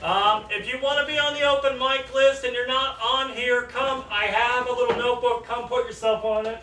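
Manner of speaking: calling out an announcement to a crowd; bar voice. Real, not acted. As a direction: project it high and loud to everyone in the bar. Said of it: "That's the bar voice".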